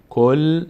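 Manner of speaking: "کل" ending on a held, vowelless l; closed on silent letter. Shown as a demonstration. Teacher shows the first reading, syllable closed on l. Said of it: "به صورت ساکن".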